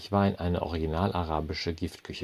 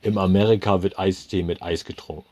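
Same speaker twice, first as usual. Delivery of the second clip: loud, bellowing manager; manager's roar; 8 dB louder than usual. A narrator's reading. Manager loses patience with the customer, loud bellow. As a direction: bellow it at him. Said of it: "brüllte er".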